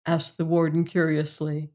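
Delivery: ordinary delivery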